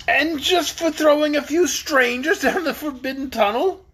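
high, loud incredulous question; incredulous protest. A narrator's reading. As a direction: protest in disbelief.

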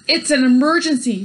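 loud alarmed shout. A narrator's reading. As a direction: call out the emergency. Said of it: "shouted Mister Watson".

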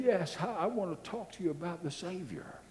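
soft, high tearful plea; mock-tearful missionary, hushed and earnest; imitated weeping. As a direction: plead gently as if still crying. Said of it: "He was audibly weeping".

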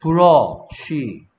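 "브러쉬" stretched out very slowly, syllable by syllable; slow model word. Shown as a demonstration. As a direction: draw out "브러쉬" so the child hears each sound. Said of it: "쓰면서 이 생각을 하란 말이야".

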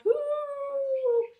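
high, long held howl; dog howl. Acted. Dog howls to help the little bird fly. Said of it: "Dog howled".

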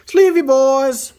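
slow, high and loud playful chant; playful call. Real, not acted. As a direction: stretch it out like a catchphrase. Playful catchphrase while sleeving cards.

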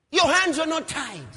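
high, loud protest; preacher's impassioned mock lament; outraged reproach. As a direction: cry it out at the dead man. Acting David's outcry over Abner.

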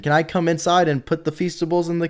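high and loud, an eager promo pitch; bright salesman pitch. Pretend. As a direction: sell it like a hyped employee. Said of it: "I work for Mister Beast".

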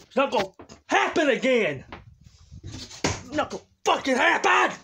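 loud, high, furious ranting; all-out crazy rage. Acted. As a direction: rage at everyone, screaming it out. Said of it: "he's all out crazy".

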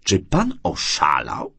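slow, rough rebuke; brusque, incredulous challenge. A narrator's reading. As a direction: throw the question out curtly. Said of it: "rzuciła szorstko".

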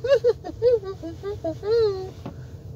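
a high, mocking laugh; preacher laughing scornfully and high. Real, not acted. Laughs at people eating live octopus.

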